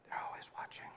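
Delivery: barely audible eerie whisper; hushed, voiceless scare-whisper; creepy whisper. Retold or imitated. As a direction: whisper it as a sinister warning. Finishing the scare told to Reed.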